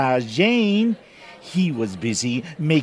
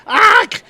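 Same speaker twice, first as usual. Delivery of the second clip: yelp of pain; 10 dB louder than usual. Acted. Hit by bagel.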